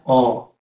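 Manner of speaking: "O" pronounced as a single pure vowel; vocal coreana O. Shown as a demonstration. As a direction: say "O" as one isolated vowel. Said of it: "¿Cómo se pronuncia esta letra?". (pronunciar "O" como vocal aislada)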